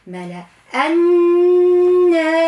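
الناس with the doubled nun held in a long nasal hum; long nasalized nun in الناس; nasal ghunna. Shown as a demonstration. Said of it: "نعطيها حق في الغنة".

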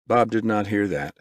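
usual speaking voice

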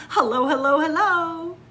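high, loud calls of hello; chameleon calling out. Acted. Reading to kids, the chameleon loudly calls hello to a cockatoo. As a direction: call out to the cockatoo.

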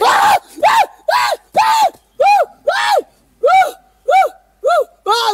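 repeated high, loud shrieks of panic; piercing frightened screams, one after another; terrified screams. Real, not acted. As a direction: scream in real terror at the shape. Screams at a ghost-like figure.

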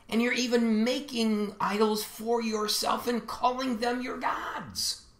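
high, insistent preaching voice; impassioned prophetic rebuke. Acted. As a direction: declaim it as a prophet's accusation, pitch held high.

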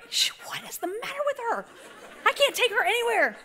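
lightly mocked son, high-pitched complaint; high incredulous grumble, played for laughs; exasperated son. Son imagined seeing his mother cry, high mock exasperation.

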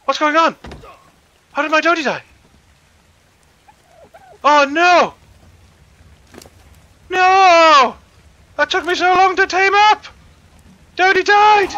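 very high, loud, anguished wailing; distraught shouting. Real, not acted. Player shouting in despair because his tamed dodo died, very high and loud. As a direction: howl in disbelief at the loss. Pet dodo suddenly killed.

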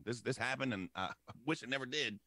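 ordinary talk, nothing marked

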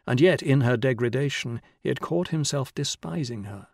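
plain reading voice

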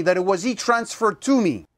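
normal talking voice

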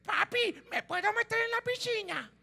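put-on high, soft child pleading; little girl voice. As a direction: ask Papi sweetly, like a small daughter. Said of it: "tu niña te dice". (voz de niña pequeña, aguda y suave)

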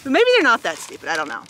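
plain reading voice

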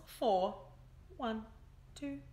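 usual speaking voice

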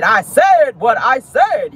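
slow, drawn-out diva line; Nene's slow defiant catchphrase; mock Nene. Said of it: "where Nene said".

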